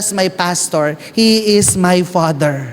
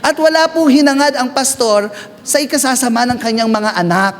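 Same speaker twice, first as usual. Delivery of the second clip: normal talking voice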